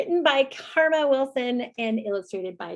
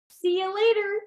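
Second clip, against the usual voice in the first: high cheeky gator; 8 semitones higher than usual. Acted. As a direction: sing it out like a smug gator. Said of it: "says the gator".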